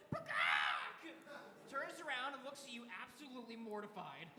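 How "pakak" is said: loud, startled kenku squawk; bird squawk. Acted. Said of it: "it goes".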